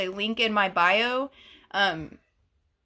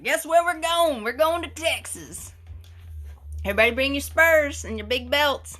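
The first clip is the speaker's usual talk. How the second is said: lightly higher, loud teasing announcement; mock Lexi; 4 semitones higher, 3 dB louder than usual. Imitating Lexi announcing a Texas meetup to everyone, light teasing. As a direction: play a friend hyping a Texas trip. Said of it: "Lexi's like".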